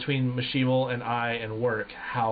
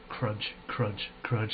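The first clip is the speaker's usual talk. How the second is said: imitated footsteps; 5 semitones lower, 6 dB quieter, much slower than usual. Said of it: "this guy's like".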